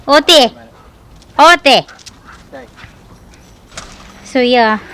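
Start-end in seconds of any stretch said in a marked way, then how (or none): 0.1-1.8 loud, high calls of the name; shouting for the dog outside; calling the dog. Real, not acted. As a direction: call the pup over.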